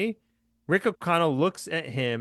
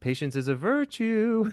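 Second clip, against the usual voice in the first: high sing-song lilt; sing-songy; 9 semitones higher than usual. Retold or imitated. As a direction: sing it out teasingly. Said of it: "in a sing-songy voice".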